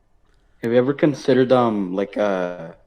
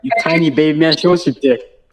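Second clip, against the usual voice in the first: higher, louder heckling; loud mocking taunt; 7 semitones higher, 6 dB louder than usual. Real, not acted. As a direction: jeer at him insistently. Goading and mocking the host.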